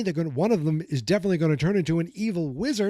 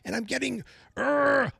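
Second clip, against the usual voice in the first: high, fast, worked-up rant ending in a growl; real rage; 9 semitones higher than usual. Real, not acted. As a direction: let the anger run away with you, fast and high. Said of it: "I am so enraged".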